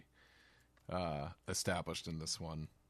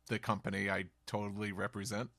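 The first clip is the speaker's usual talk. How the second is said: usual speaking voice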